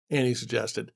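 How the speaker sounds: usual speaking voice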